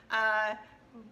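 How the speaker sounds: normal talking voice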